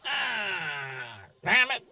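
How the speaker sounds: a strained, pained cry of an out-of-shape body; mock strain. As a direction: play a body that gives out mid-stretch. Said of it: "That shit goes".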